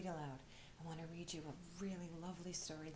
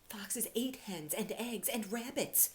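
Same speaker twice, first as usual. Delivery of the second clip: hushed; 3 semitones higher, 5 dB louder, slower than usual. A narrator's reading.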